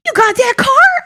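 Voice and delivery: very high, loud, incredulous question rising on "car"; amazed question. Retold or imitated. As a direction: imitate a principal asking in astonishment. Retold principal's amazed question.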